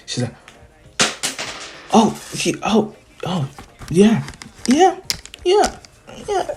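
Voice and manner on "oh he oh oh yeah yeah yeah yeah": high squeaky stammer, climbing; breaking into mock squeals of agreement; flustered yes. Uraraka asked on a date, flustered and squeaky.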